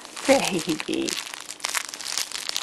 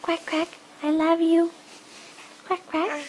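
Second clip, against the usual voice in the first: toy duck voice; 8 semitones higher, 3 dB quieter than usual. Pretend.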